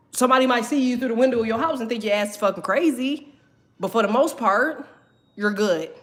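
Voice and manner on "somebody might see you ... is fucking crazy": ordinary talk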